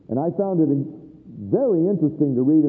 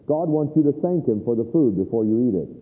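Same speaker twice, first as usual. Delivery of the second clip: ordinary talk, nothing marked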